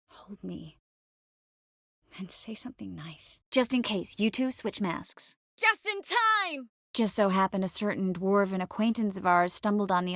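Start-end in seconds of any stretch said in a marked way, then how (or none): 0.1-3.4 very soft, intimate murmur; tender hushed plea. Acted. 5.6-6.7 high, drawn-out cry; high exclamation. Acted. Urgent exclamation in the action.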